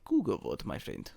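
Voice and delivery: low, hammed-up Russian accent; badly faked Russian accent; mock Russian accent. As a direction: put on a bad Russian accent for fun. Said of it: "putting on a Russian accent".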